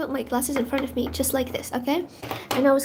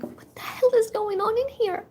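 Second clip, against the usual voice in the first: much higher, baffled inner cry; bewildered; 8 semitones higher than usual. Retold or imitated. Student confused while classmates smile at her, bewildered. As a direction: sound completely lost. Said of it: "I was like".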